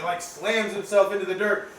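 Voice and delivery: ordinary delivery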